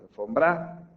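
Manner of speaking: alfomBRA, rising final BRA; wrong stress on last syllable. Shown as a demonstration. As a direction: push the beat onto BRA in Alfombra.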